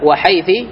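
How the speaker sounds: وحيث ending in a short "i", wa-haythi; final kasra. Shown as a demonstration. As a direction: say وحيث with a kasra on the last letter. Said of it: "بالكسر".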